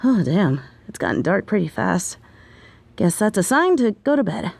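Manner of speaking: ordinary delivery, nothing marked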